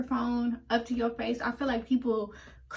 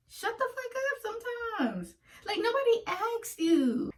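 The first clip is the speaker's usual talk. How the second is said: exasperated; 11 semitones higher than usual. Acted. Snapping at imagined commenters.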